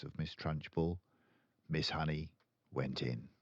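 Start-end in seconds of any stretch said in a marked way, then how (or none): none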